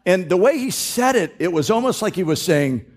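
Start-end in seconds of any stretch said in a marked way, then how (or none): none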